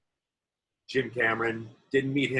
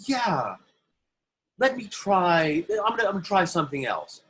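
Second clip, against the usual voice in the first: fussy actor; 8 semitones higher, 4 dB louder than usual. Retold or imitated. Actor on set asking the director for another take, high and fussy. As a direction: play the precious actor who wants one more go. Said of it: "an actor might go".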